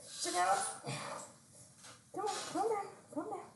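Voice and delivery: high, soft soothing pet voice. Real, not acted. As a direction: settle a wriggly puppy. Calming restless dog.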